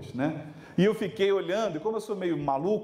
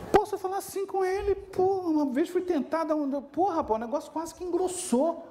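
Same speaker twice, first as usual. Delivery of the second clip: much higher, amazed voice of a nervous fellow teacher; wary colleague; 9 semitones higher than usual. Retold or imitated. Colleague asks if he may talk that way to Gustavo: higher, amazed voice. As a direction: sound like you barely dare ask. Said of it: "Por quê?".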